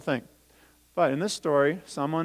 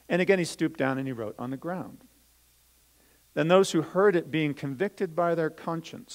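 put-on louder, lively storytelling voice for children; Bible story reading; 4 semitones higher, 6 dB louder than usual. Teacher reading the Bible story to children, lively storytelling. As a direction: read it out as a story to the kids.

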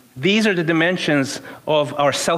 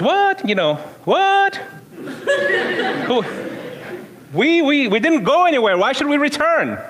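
incredulous protest; 11 semitones higher than usual. Retold or imitated. Shocked man confronted about giving.

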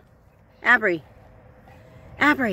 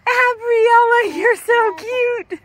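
much higher, slow sing-song cooing at the dog; 10 semitones higher than usual. Real, not acted. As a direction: coo at a dog in a sheep costume. Cooing at the dressed-up dogs.

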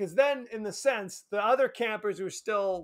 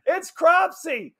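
higher, louder mock-startled exclamation; startled cry; 7 semitones higher, 7 dB louder, slower than usual. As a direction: blurt it like a startled movie character. Voicing startled characters in movie retelling.

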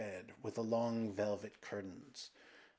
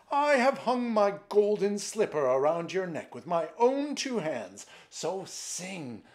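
loud, high desperate pleading; screamed; 6 semitones higher, 11 dB louder than usual. A narrator's reading. Emperor to his golden bird, screaming for a song. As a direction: beg and command at raised pitch. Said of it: "screamed the emperor".

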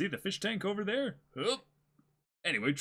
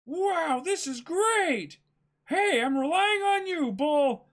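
much higher, louder, slow gushing; delighted Luffy; 11 semitones higher, 5 dB louder, slower than usual. Acted. Luffy praises his bull, high and loud. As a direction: marvel like an excited boy.

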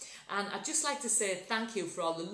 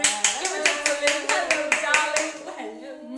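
showman announcement; 8 semitones higher, 11 dB louder than usual. Acted. Introduces friend like a showman.

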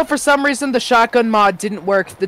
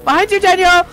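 shouting warning; 7 semitones higher, 3 dB louder than usual. Real, not acted. Warning teammate under attack.

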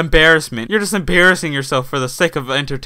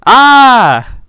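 a high, loud, sudden scream; 9 semitones higher, 10 dB louder than usual. Shown as a demonstration. Jump-scaring viewers for surprise, loud high scream. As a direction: shriek out of nowhere to startle. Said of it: "You didn't expect me to scream".

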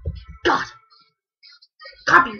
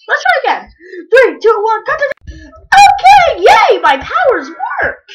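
much higher and louder triumphant cheer; excited shouting; 11 semitones higher, 11 dB louder than usual. Pretend. Kid cheers as pretend powers work, loud excitement. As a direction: celebrate the magic working.

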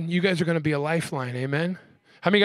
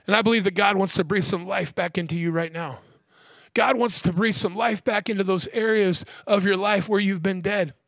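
usual speaking voice

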